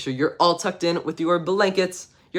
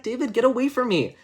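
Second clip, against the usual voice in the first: put-on higher, whiny girl voice; annoyed girl; 8 semitones higher than usual. Sally tells David to get away, in a high annoyed voice. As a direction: push him away. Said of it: "Sally's like".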